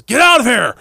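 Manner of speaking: high, loud hammed-up dismissal; mock yelled quote. Retelling Matt throwing someone out, high and loud. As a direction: bark it like a cheesy wrestler. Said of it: "he goes".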